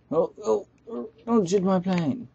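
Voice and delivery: sudden panic as the plane is hit; panicked plea. Pretend. Gamer whose plane is being shot, panicked plea. Plane under fire again.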